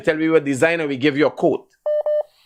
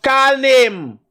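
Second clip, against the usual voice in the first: loud, raised demand; angry shout; 7 semitones higher, 4 dB louder than usual. Real, not acted. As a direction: bark it at the stalling caller. Host repeats angry demand.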